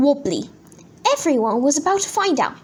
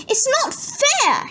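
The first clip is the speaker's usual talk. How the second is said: high, drawn-out whine of protest; mock shriek; 11 semitones higher, slower than usual. A narrator's reading. Henry protests over the chocolates, high mock shriek. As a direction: shriek it slowly, without full volume. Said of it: "shrieked Henry".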